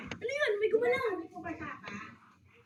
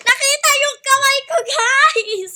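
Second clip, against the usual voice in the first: loud laughing exclaim, higher; laughing loudly; 7 semitones higher, 11 dB louder than usual. Real, not acted. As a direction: laugh out at being caught. Caught, her hand was spotted.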